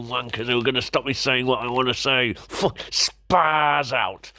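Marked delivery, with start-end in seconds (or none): 2.3-4.3 very slow, each word stretched; defiant drawn-out repeat. Real, not acted. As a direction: drag it out to provoke the censors. Drawn-out mock repetition.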